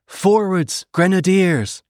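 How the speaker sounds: high-pitched rallying cry to troops; officer's ringing order to advance; battle command. A narrator's reading. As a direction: call the charge out, stretching each word. Officer giving the charge command.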